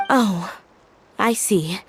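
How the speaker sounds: usual speaking voice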